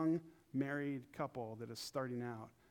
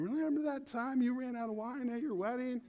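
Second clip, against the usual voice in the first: much higher, louder taunting relative; mocking relative; 11 semitones higher, 6 dB louder than usual. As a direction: rub it in like an old relative at a family dinner. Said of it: "He'd be, like, eighty years old".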